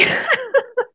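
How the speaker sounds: bright high-pitched burst of laughter; a delighted laugh at the host's shock. Real, not acted. Physio laughing after shocking the host, high and bright.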